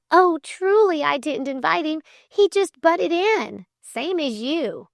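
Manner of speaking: high, flustered excuse; blushing. A narrator's reading. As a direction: explain it hastily embarrassed, in a high voice. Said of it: "blushed Flame".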